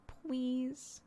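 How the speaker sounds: high, soft little please; a hushed toddler please. Retold or imitated. Mom mimicking how her daughter says please, high and soft. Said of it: "she says".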